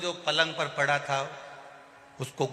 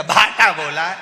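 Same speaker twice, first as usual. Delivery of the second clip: louder snap; curt loud retort; 2 semitones higher, 10 dB louder than usual. Retold or imitated. Patient answers the deaf visitor, curt loud retort. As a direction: throw the answer back.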